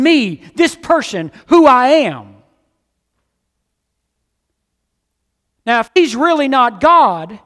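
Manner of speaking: high, loud, fervent preaching; full-force preaching. Acted. Pastor pressing the congregation on forgiveness, fervent and loud. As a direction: drive it home to the congregation.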